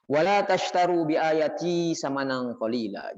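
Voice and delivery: drawn-out scriptural recitation; Quran recitation. Acted. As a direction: recite the verse slowly and measuredly. Reciting a Quran verse aloud.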